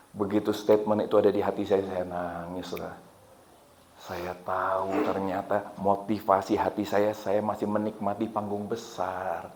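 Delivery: low, hushed, heavy admission; humbled, quiet testimony; subdued confession. Real, not acted. Confesses tearful self-discovery, subdued.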